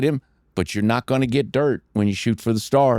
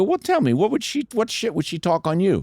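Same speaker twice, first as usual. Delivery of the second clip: higher, pressing question; coaxing question; 8 semitones higher than usual. Retold or imitated. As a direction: nudge him to spill it. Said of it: "I go".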